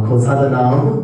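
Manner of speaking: calling out. Real, not acted. Preacher calls congregation to respond.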